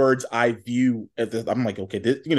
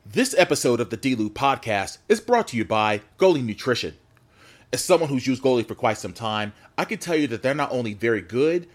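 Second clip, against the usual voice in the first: ad read. Acted. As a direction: sell it like a sponsor spot. Sponsor ad read.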